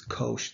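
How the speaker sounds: kosh said with a long O, as in kosher; kosh with the O sound. Shown as a demonstration. Teacher contrasting the American reading, long O. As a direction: lean on the O of kosh. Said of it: "the O sound".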